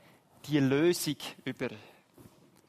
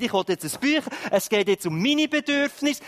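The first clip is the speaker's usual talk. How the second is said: put-on demanding child; 7 semitones higher, 6 dB louder than usual. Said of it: "kommen sie ans Bein und sagen".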